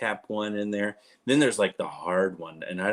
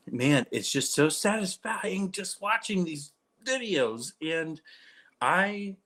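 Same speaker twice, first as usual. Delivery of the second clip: higher, slowed, savouring each word; blissful higher drawl about satisfying videos; dreamy delight; 7 semitones higher, slower than usual. Real, not acted. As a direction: sigh it out like pure bliss. Gushing about earwax videos.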